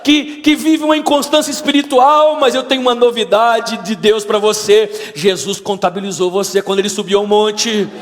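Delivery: high, loud, impassioned sermon climax; full-force preaching. Real, not acted. Preacher telling doubters Jesus won't give up on them, full-force preaching. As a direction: drive the promise home to the congregation.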